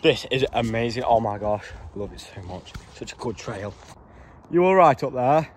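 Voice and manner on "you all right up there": calling up. Real, not acted. Calling up to friend above.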